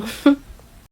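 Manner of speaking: high rueful laugh; a quick high laugh at her own misery; short laugh. Real, not acted. Sick woman laughing at her flu woes.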